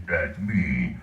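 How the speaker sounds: low character growl; Strong from Fallout. Acted. Voice actor showing fans his game role, low character voice. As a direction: drop into a deep, drawn-out game-character voice. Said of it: "I play Strong".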